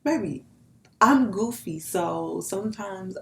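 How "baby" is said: normal talking voice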